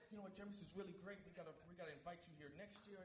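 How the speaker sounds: fast, high gushing praise; gush, high and quick; excited praise. Retold or imitated. Voicing the people's praise.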